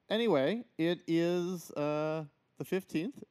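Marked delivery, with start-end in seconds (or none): none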